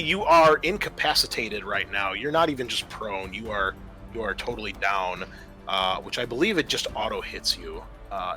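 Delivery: normal talking voice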